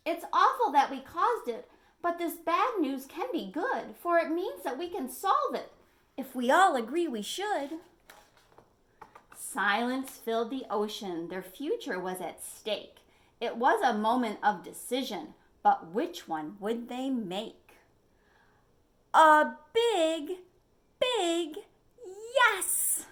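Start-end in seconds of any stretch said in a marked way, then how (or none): 0.1-7.8 rallying speech. Acted. Mister Fish rallying. 19.1-23.1 loud rising refrain ending in a high yes; triumphant cheer. A narrator's reading.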